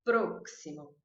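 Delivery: "Próximo" with a closed O and a KS for the X. Shown as a demonstration. Teacher pointing out the closed O sound. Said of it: "Olha o som do O fechado".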